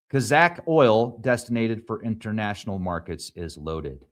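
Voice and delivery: ordinary talk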